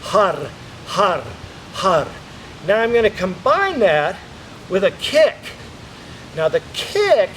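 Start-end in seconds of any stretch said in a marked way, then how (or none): 0.0-2.1 "Har" with a rolled Spanish R. Shown as a demonstration. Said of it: "like a Spanish R".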